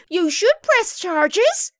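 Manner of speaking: loud, high, slow insistence; indignant declaration. A narrator's reading. Muriel urges that charges be pressed, indignant. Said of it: "Muriel declared".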